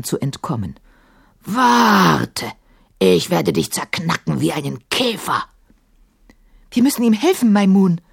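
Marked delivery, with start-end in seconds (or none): 1.4-5.4 long roared first word, then menacing growl; threatening djinn roar. A narrator's reading. Sahim threatening to crush Kafur like a beetle, roaring. 6.7-8.0 urgent call. A narrator's reading. Emma urging Maimun to help Kafur, calling out. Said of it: "rief Emma".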